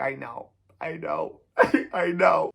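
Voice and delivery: high mock-whimper, breaking into laughter; retold tearful admission. Tearful driver admits speeding to cop, mock whimpering. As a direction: whimper agreement as if in tears. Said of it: "I'm in tears".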